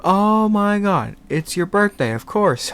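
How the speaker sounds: dawning shock at the forgotten birthday; mock gasp of realisation; sudden realisation.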